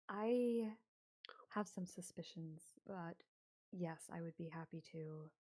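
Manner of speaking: reserved Cassandra. Acted. Cassandra agreeing to help Eva investigate, softly. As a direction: answer softly and with care, pausing. Game master voices Cassandra.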